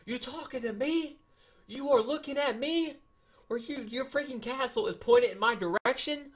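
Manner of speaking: very high, incredulous challenge; mock outrage. Gamer challenging a rival castle pointed his way, mock outrage. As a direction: squeal it like you're picking a fight.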